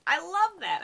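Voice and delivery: high, loud fangirl squeal; delighted squeal. Real, not acted. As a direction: squeal it out like a fan. Delighted outburst after the quote.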